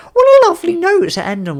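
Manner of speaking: high, loud, gushing delight; over-the-top cheerful sign-off. Real, not acted. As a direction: beam it out like a game-show host. Cheery sign-off, much higher.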